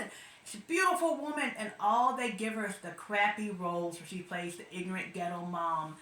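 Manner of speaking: usual speaking voice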